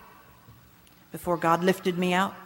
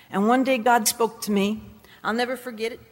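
usual speaking voice

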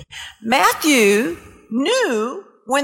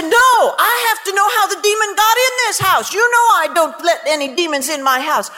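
indignant retort; 6 semitones higher than usual. Retold or imitated. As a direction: insist hotly on finding the cause. Indignant argument with husband.

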